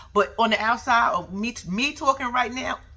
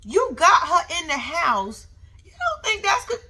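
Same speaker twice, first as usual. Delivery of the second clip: incredulous comeback; 8 semitones higher, 4 dB louder than usual. Retold or imitated. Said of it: "I said".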